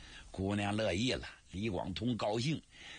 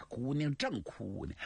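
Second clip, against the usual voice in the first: ordinary delivery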